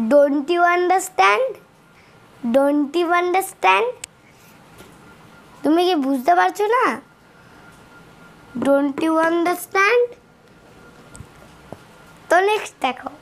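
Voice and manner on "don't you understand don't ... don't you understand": Don't you understand drawn out slowly; slow teaching voice; slow English demo. Shown as a demonstration. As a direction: model it slowly for learners. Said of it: "এখানেও লেখা আছে".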